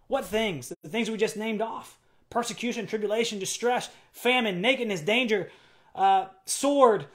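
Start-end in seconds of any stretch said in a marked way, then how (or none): none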